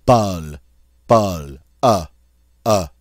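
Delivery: "Bol" with vowel between hot and hut; French "Bol", then its vowel "Uh" alone. Shown as a demonstration. Teacher drills French word, in-between O. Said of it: "somewhere in between there".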